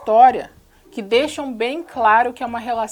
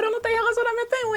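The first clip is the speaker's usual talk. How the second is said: mocking; 12 semitones higher, faster than usual. Mocking the film's logic.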